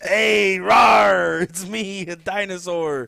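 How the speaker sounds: loud shouted dinosaur voice with rawr; T-Rex roar. Acted. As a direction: roar it like a cartoon dinosaur, slowing at the end. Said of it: "Do you wanna hear my impression of a T-Rex?".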